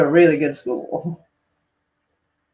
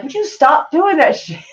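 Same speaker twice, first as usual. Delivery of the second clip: higher-pitched imitation of a fed-up boyfriend; mock exasperation; 8 semitones higher than usual. Ex-boyfriend fed up with her accent, retold in mock exasperation. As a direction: plead in mock irritation. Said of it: "it drove him nuts".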